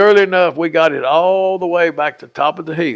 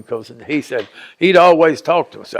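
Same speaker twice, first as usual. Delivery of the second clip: ordinary talk, nothing marked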